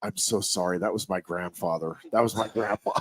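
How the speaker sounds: a hint of an embarrassed girl; quiet mock apology to the umpire; sheepish apology. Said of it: "She goes".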